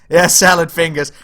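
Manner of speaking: ordinary talk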